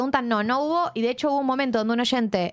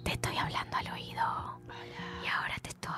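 ASMR whisper; 13 dB quieter than usual. Shown as a demonstration.

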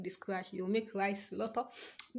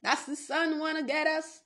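higher, drawn-out, sing-song line; half-sung warning about the sun; slow sing-song; 6 semitones higher than usual. Acted. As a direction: draw out "That's the sun wanna get us" slowly and higher. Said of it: "I hate the word wanna".

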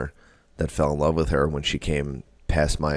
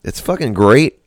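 emphatic defence; 7 semitones higher, 9 dB louder than usual. Real, not acted. Defending his French accent.

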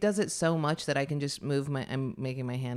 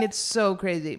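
ordinary delivery

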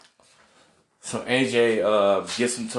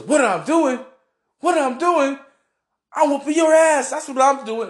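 high, loud mock yell, climbing as it goes; retold yelling; 5 dB louder than usual. Undertaker yelling back at David Flair, high mock shout. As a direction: belt it out like a furious wrestler taunting. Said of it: "And Undertaker goes".

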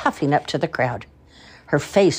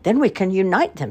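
village women; 6 semitones higher, 3 dB louder than usual. A narrator's reading. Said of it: "said the women".

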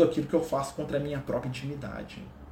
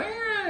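very high mock cat yowl; imitated angry cat. Owner shows his cat getting nervous with him, imitated yowl. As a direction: squeal like an annoyed cat. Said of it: "ela fica nervosa aqui comigo".

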